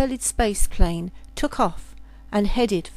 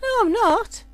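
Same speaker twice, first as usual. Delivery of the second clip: much higher, protesting; insistent denial; 12 semitones higher, 4 dB louder than usual. Acted. Girl denying she is the princess, high protest. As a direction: deny it with a squeak of panic.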